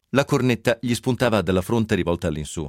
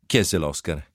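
plain reading voice

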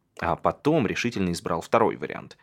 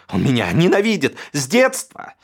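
high, loud, shrill-edged tirade against his brother; rant, near tears, self-pitying fury; ruined heir screaming at his older brother; hysterical brother; 9 semitones higher, 8 dB louder than usual. A narrator's reading. Alexander's hysterical accusing rant.

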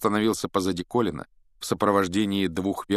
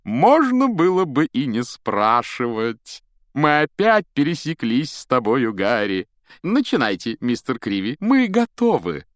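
high, loud, stretched showman's lilt; sing-song gushing; 6 semitones higher, 6 dB louder than usual. A narrator's reading. Lockhart claims Harry for a photo, singing out the words. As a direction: croon it with grand self-delight. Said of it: "пропел".

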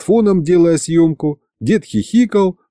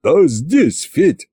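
wheezing reply; 3 semitones higher, much slower than usual. Acted. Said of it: "прохрипело вдруг".

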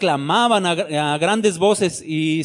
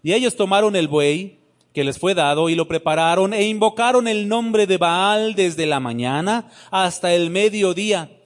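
usual speaking voice

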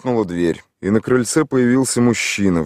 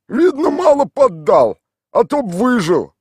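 high, put-on village voice; gruff local man answering a stranger; rough villager; 6 dB louder than usual. A narrator's reading. Tolik answers the city stranger in a rough village voice.

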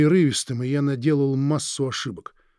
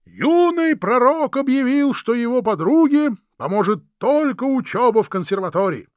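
loud, high herald's cry; town crier; 6 semitones higher, 3 dB louder than usual. Acted. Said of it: "изобразил крики глашатая". (громкий клич глашатая)

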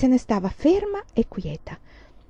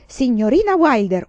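loud, raised cry to the teacher; furious, higher-pitched call; angry yell; 4 semitones higher, 8 dB louder than usual. A narrator's reading. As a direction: yell it out in fury. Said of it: "urlò".

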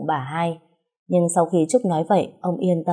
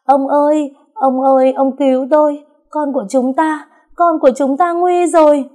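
high, loud hammed-up wail; desperate high-pitched wailing, stretching the last plea; wailing cry for help; 9 semitones higher, 6 dB louder than usual. A narrator's reading. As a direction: cry out to your husband in panic, pitched way up. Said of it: "Bà hai gào khóc".

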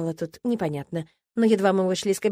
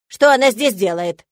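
displeased; 10 dB louder than usual. A narrator's reading. Old teacher displeased at heroine.

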